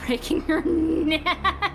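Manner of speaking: loud, slow, breaking into laughter; laughing disbelief. Real, not acted. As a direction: drag it out loudly through a laugh. Laughs at sudden silly death.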